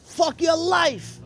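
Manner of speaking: high, drawn-out mock yell; retold heckling yell. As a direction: call it out slowly from the crowd. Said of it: "and I yell out".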